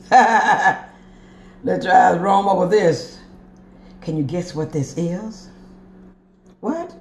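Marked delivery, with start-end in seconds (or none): 0.0-3.2 very slow, louder, through a laugh; playful showcase, hammed up; laughing, drawn-out invitation. Shown as a demonstration. 6.6-6.9 high, quick pretend question; playful pretend "what"; mock surprise. Pretends to hear a viewer's guess.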